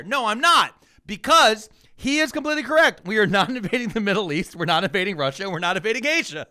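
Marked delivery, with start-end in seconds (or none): none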